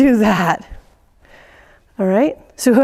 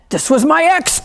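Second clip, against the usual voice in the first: mock venting; 7 semitones higher than usual. Hitting the ball, voicing venting.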